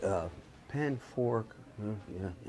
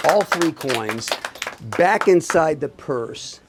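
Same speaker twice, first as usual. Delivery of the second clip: slow and deliberate, a bit louder; 3 semitones higher, 6 dB louder, much slower than usual. Shown as a demonstration. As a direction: spell it out slowly for the reveal. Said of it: "I'm gonna do it slow".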